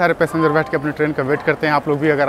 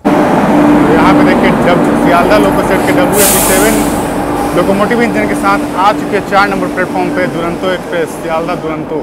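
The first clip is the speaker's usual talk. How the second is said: loud over train noise; 4 semitones higher, 7 dB louder than usual. Real, not acted. Calling over arriving locomotive.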